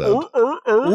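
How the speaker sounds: high barking walrus grunts; walrus sound. Retold or imitated. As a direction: bark out walrus noises. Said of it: "some type of walrus sound".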